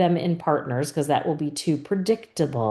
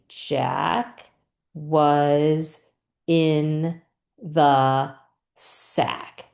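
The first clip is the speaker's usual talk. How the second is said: Jack, was, in, the, sack, each separated by a pause; halting one-word-at-a-time reading; much slower than usual. Shown as a demonstration. Teacher models touching each dot, word by word. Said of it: "touch each dot and say".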